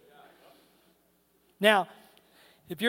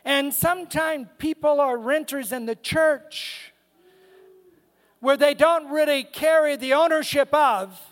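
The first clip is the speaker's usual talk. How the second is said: slow, weighty preaching with long pauses; deliberate, punched-out pulpit delivery; emphatic preaching; 3 semitones higher, 3 dB louder, slower than usual. Real, not acted. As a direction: stretch out each key word for the congregation.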